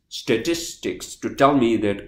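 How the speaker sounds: ordinary talk, nothing marked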